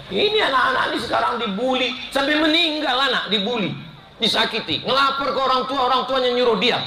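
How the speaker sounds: high, fast, urgent outrage; fired-up preaching. Real, not acted. Preacher rousing parents about bullied children, high fast outrage. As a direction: rush it out, pitch climbing with indignation.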